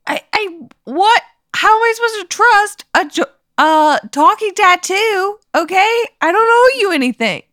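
high, fast defensive pleading; agitated protest. Acted. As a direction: talk fast and high, indignant. Arguing with the tattoo.

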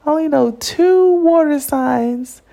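high, strained mock wail; imitating herself sobbing over two bad options; retold crying. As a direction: whine it out like a crying fit. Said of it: "I cried 'cause I was like".

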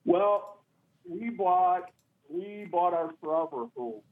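faint voice, far from the phone; phone held away. Real, not acted. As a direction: caller too far from the mic. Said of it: "Can you speak up, sir, please?".

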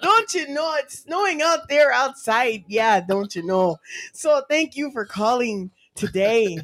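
swooping Minnesota lilt with long vowels; Minnesotan accent. Shown as a demonstration. Chatting about snow outside, Minnesotan accent. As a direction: play up a folksy Minnesotan accent.

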